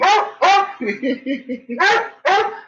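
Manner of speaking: long high laughter; high giggle at the dog. Real, not acted.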